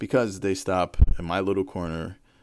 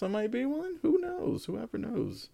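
very high, teasing; high playful squeak; 14 semitones higher, 5 dB quieter than usual. Real, not acted.

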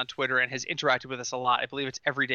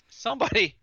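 stifled laugh breaking through the word; fighting back a laugh; 6 semitones higher than usual. Real, not acted. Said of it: "trying not to laugh at this".

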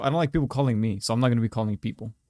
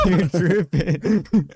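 laughing through a slow, louder repeat; 4 semitones higher, 6 dB louder, much slower than usual. Real, not acted. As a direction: crack up while repeating it.

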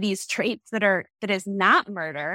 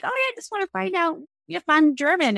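higher, lightly airheaded casual voice; mock carefree person; 10 semitones higher, faster than usual. Mimicking a casual person who only wants to know their heritage, lightly airheaded. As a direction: toss it off like a carefree naive person. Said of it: "they'd be like".

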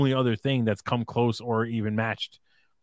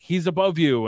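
higher-pitched, mildly urgent retold warning; retold pirate alarm; 6 semitones higher than usual. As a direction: lightly act out a pirate tracking a fast enemy. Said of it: "the pirate that runs away is like".

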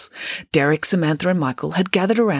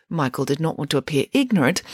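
usual speaking voice

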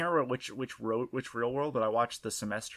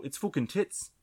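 "it's fucking tits" in a hammed-up Australian accent, pitched higher; mock Aussie accent; 5 semitones higher than usual. Host delivering the punchline as a fake Aussie patron, mock Australian accent. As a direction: land "fucking tits" like a cheeky Aussie bloke. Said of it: "That's a really convincing accent".

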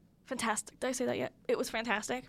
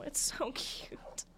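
slow, whispered, with a laugh; a soft little whisper over something tiny; gushing whisper; 2 semitones higher, 7 dB quieter, much slower than usual. Real, not acted. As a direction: coo it under your breath, slowly. Gushing over stuffed animal.

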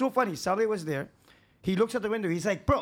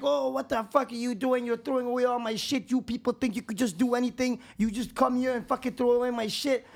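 mildly raised, higher-pitched mock rant; retold angry yelling; 6 semitones higher than usual. Old man confronting workers clearing his yard, retold angry yelling. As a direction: imitate an irate man's complaint, voice pitched up. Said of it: "all this yelling outside".

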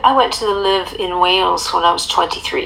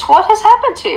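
mock dismay; 12 semitones higher, faster than usual. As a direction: gasp it like a shocked aunt.

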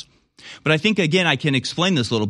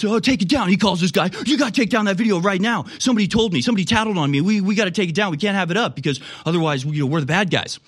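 higher, panicky orders to take it down; panicked capitulation; 5 semitones higher than usual. Retold or imitated. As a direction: voice the boss caving in a hurry. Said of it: "He capitulates".

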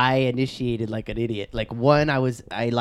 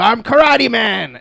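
mock battle cry; 8 semitones higher, 6 dB louder, slower than usual.

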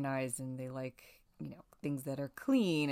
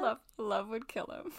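laughing; 8 semitones higher than usual. Real, not acted. Laughing at the joke.

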